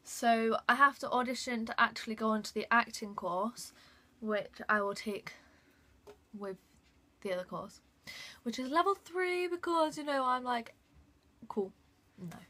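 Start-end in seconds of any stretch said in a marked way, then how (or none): none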